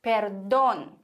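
Perdón, leaning firmly on DÓN; stress on second syllable. Shown as a demonstration. Teacher shows the accented O in Perdón, stressing DÓN. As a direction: punch the final syllable of Perdón. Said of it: "unbedingt diese Silbe betonen".

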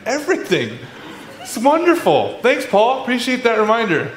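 high-pitched sarcastic cheer with a laugh; laughing, hammed-up fake enthusiasm; mock delight. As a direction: gush thanks for news you dread. Mock thanks for a frightening reminder.

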